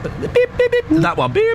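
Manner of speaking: high beeping noises; phone beeps. Shown as a demonstration. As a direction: imitate a phone's beep. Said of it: "I'm hanging up".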